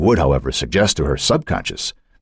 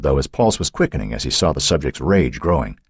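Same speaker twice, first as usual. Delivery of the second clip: ordinary delivery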